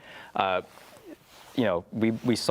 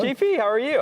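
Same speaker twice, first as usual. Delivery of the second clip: excited greeting; 5 dB louder than usual. Real, not acted. Excited greeting of arriving guest.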